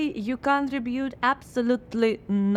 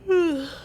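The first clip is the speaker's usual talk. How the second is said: heavy sigh; 9 semitones higher than usual. Acted. Alone after muse leaves.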